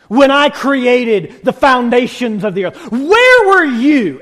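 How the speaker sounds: God pressing harder, slow and deliberate; God challenging. Retold or imitated. God questions Job, building slow and loud. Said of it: "He says".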